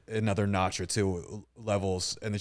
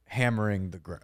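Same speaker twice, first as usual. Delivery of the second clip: ordinary talk, nothing marked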